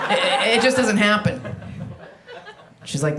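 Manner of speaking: ordinary delivery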